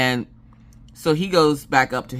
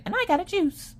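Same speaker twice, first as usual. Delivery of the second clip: put-on much higher child's chirp; kid voice. Daughter chiming in about her juice, high childlike chirp. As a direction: chirp it like a proud little kid. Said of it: "Maggie's like".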